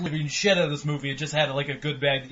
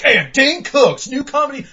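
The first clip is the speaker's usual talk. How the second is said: much higher, louder, slow mock TV promo voice; TV announcer; 11 semitones higher, 11 dB louder than usual. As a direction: hype it like a cheesy channel ad. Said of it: "Comedy Central be like".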